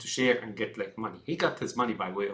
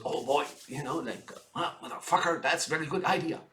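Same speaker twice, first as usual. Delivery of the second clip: higher, excited mock voice of a greedy politician; a put-on, giddy voice, pitched up; imitating an eager Biden; 5 semitones higher than usual. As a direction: ham up his glee at the money. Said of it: "And he goes".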